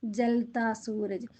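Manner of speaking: ജൽത്താ Sooraj slowly, stress on ജൽത്താ; slow teaching read. Shown as a demonstration. Teacher to pupils, modelling the phrase slowly. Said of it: "എന്താ പറയുന്നത്?".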